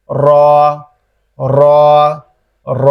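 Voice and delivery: র with the tongue curled back to the palate, repeated; Arabic ra demo. Shown as a demonstration. Teacher drills the letter ra for students, clear and repeated. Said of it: "লেগে উচ্চারিত হবে".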